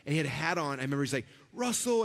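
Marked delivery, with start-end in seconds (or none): 1.5-2.0 big, high-pitched hello; excited greeting. Retold or imitated. Host greets guest Russell with a big excited welcome. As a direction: call his name like a delighted old friend. Tony greets him warmly by name.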